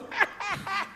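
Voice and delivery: high, soft cackle; high mock giggling; imitated laughing fit. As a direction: act out a distant friend cracking up. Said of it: "a cabecinha do Maurício assim".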